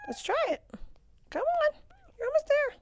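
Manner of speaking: cheering pet talk. Real, not acted. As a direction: urge the goat on sweetly. Coaxing the goat to stand.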